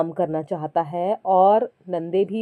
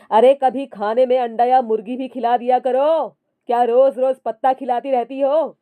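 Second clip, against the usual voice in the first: louder complaining yell; shouting; 4 semitones higher, 5 dB louder than usual. Acted. Husband shouting at his wife about the food, loud. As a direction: bark it across the kitchen. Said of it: "चिल्लाकर बोला".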